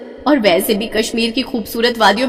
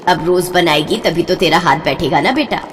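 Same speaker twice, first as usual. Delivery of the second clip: ordinary talk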